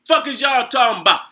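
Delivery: usual speaking voice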